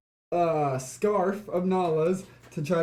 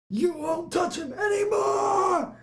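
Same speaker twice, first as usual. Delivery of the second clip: high, drawn-out yell; protective battle cry; 14 semitones higher than usual. Acted. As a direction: stretch it into a slow, high war cry. Player's character leaps to protect friend.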